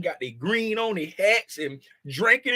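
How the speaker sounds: ordinary talk, nothing marked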